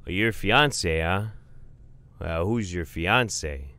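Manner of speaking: put-on slow questioning in a Fargo accent. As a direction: quiz the newcomer in Northern Michigan accent. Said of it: "Manitoba-ish sounding Fargo accent".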